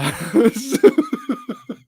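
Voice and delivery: squealing high laugh; loud burst of laughter. Real, not acted. Host laughs at chat.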